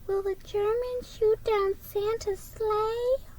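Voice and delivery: put-on high, slow, soft little-boy worry; small boy. Brad asking his mother about Santa's sleigh, high and slow. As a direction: ask it small and timid.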